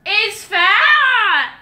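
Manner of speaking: high shouted query; screaming. Real, not acted. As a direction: yell the guess back as a question. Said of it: "He's screaming at me".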